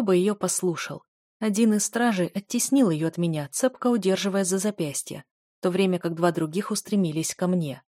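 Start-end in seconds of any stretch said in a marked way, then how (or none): none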